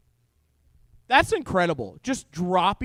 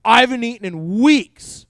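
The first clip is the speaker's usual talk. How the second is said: mock exaggeration; 7 dB louder than usual. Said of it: "throw out some ridiculous statement".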